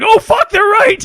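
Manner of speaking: high, slow, mock-panicked cry; retold panic. Telling friends the magnet stuck to his arm, retold panic. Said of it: "I did panic".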